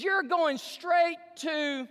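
slow, loud, high-pitched buildup; dramatic shout. Retold or imitated. Preacher mimicking the ad's cliffhanger, slow loud shout. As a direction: stretch it out for suspense. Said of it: "He says".

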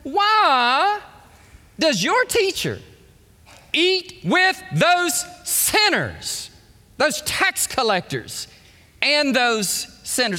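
high, slow, scornful accusation; hammed-up sarcastic disdain. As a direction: play a scandalized Pharisee, leaning on each word. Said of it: "hear the sarcasm".

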